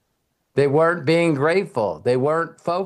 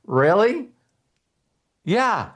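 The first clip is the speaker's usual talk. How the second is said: mock surprise; 6 semitones higher, slower than usual. Incredulous exchange, player and coach.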